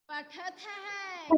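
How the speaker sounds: पठत है said slowly, syllables apart; slow model reading. Shown as a demonstration. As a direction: stretch पठत है out for the class. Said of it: "क्या हो जाएगा?".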